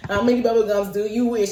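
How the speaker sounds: usual speaking voice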